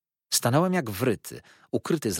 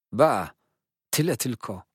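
a louder scoff, then dismissive; scornful; 3 semitones higher, 5 dB louder than usual. A narrator's reading. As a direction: scoff at the paltry sum. Blackmailer scoffs at money. (lekceważąco)

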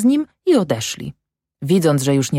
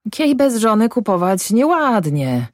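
said with a smile; 7 semitones higher than usual. A narrator's reading. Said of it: "odparł, uśmiechając się".

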